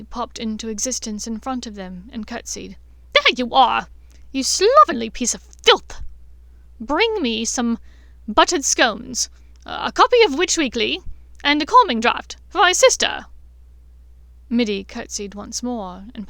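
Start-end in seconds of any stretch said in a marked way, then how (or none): 3.1-13.3 loud, higher berating of a servant; loud haughty scolding. Acted. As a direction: bark orders with contempt. Bellatrix berating the elf.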